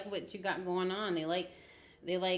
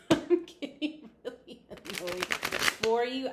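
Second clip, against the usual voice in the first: slow, laughing through the words; 4 semitones higher, 5 dB louder, slower than usual. Real, not acted. Reader laughing at the annoying card for the viewer. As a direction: giggle while admitting annoyance.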